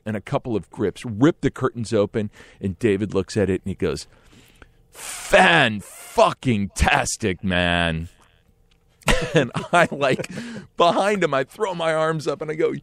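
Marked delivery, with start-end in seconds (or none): 4.9-8.1 slow, each part of Fan-fucking-tastic stretched out; drawn-out verdict. Retold or imitated. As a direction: savour every syllable. [name]'s delighted verdict.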